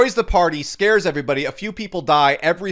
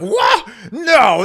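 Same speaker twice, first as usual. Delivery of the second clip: much higher, loud mock gasp of disbelief; shocked disbelief; 7 semitones higher, 8 dB louder than usual. Imitating Twitter people reacting with loud mock shock. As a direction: squawk it like it's outrageous. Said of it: "People were like".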